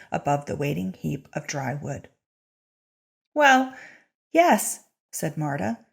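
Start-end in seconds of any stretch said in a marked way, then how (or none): 3.3-4.8 pitched up and louder, defensive; a flustered squeak of agreement, as if caught out; startled, higher and louder admission; caught-out high yes. Acted. Marta caught out, defends herself.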